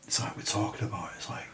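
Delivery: hushed fast whisper. Real, not acted. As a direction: whisper it nervously. Ghost hunt, scared hush.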